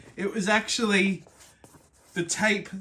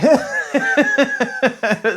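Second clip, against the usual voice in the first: loud laugh; 5 semitones higher, 6 dB louder than usual. Real, not acted. Laughing on camera.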